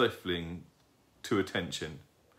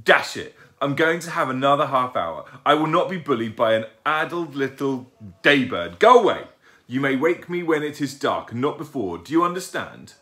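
loud, raised-pitch scolding; cross father; 5 semitones higher, 8 dB louder than usual. A narrator's reading. Father owl scolding the owlet who woke him, cross. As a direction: lay down the law, building to a loud order. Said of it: "He glared at Plop".

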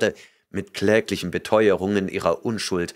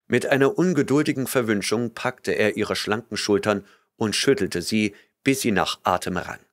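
ordinary talk, nothing marked

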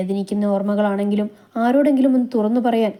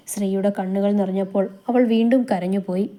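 plain reading voice

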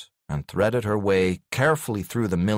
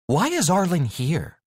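curious; 11 semitones higher than usual. Acted. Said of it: "she said curiously".

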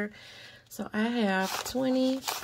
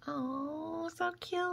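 much higher, soft squeal of delight; gushing; 9 semitones higher, 8 dB quieter, slower than usual. Real, not acted. Vlogger showing viewers her matching planner, high soft gushing coo. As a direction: coo over it like a tiny puppy.

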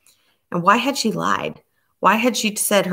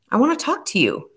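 higher, sharp angry snap; a raised, snapping demand; 7 semitones higher than usual. A narrator's reading. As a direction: snap it at her in anger. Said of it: "Rachel snapped, anger making her voice high and shrill".

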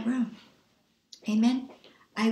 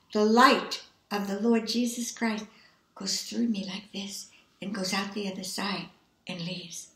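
ordinary delivery

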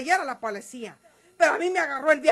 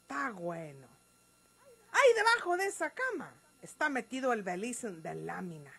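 much softer, low resigned voice; quiet grudging consent; 6 semitones lower, 9 dB quieter than usual. Acted. María Eugenia grudgingly tells Gumaro where it is, quietly. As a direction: give in under your breath.

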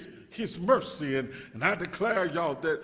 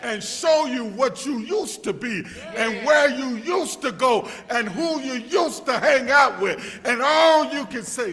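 louder, rising preacher's call toward a shout; full-force preaching; 6 semitones higher, 10 dB louder than usual. Acted. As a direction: build it up like a Sunday climax.

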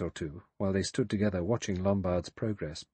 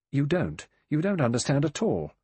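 higher, louder, heated denial; sharp contradiction; 6 semitones higher, 5 dB louder than usual. A narrator's reading. Vera insisting the general doesn't understand, sharply. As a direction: push back sharply.

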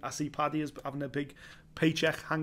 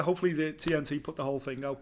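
ordinary delivery

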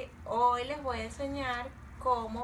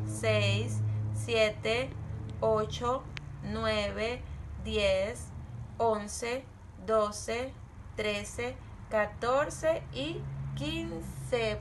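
put-on drawn-out count for children to follow; slow counting; much slower than usual. Shown as a demonstration. Teacher counting slowly along with the children. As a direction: stretch each number slowly. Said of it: "Ayúdenme".

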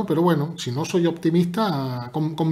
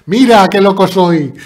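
loud, higher-pitched playful yell; mock crazy shout; 4 semitones higher, 13 dB louder than usual. Streamer answering chat that called him crazy, mock crazy shout. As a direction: blast it out as if gleefully mad.

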